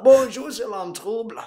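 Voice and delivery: high, lilting mock Antillean accent; l'homme trouble, Antillean accent. Inviting Commander Shepard aboard, mock Antillean accent. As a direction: put on a Caribbean French accent, high-pitched. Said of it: "un accent antillais".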